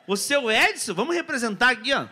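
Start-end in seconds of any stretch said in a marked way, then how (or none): none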